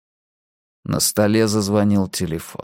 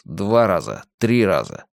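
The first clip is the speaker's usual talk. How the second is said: plain reading voice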